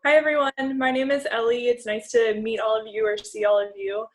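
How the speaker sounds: ordinary talk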